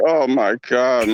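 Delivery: low, slow groan of dread; real dismay. Real, not acted. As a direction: sink into it, drawn out and despairing. Fan dreads losing the series.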